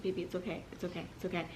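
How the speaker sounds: soft, hushed reassurance; reassuring. Retold or imitated. Husband calming his frightened wife, soft and reassuring. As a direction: soothe her gently.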